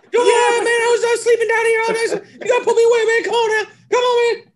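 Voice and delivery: very high, loud squawking megaphone voice; [name] impression. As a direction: screech it through a megaphone.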